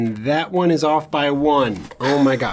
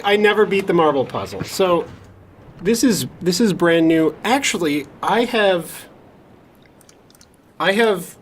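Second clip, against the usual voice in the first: ordinary delivery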